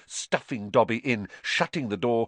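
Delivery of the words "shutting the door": plain reading voice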